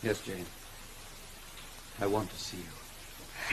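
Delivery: slow, low and soft, brooding. Acted. Rochester tells Jane he wants her, brooding and slow. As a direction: let each word sink, quiet and heavy.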